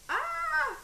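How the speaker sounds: high yelp as the onion stings; a sudden high cry of pain. Real, not acted. Onion tears.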